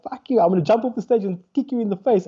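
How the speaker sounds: high-pitched, worked-up retort; heated mock threat. Comic threatening heckler from stage, retold heatedly. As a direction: fire back indignantly, pitch jumping up. Acts his threat to the man.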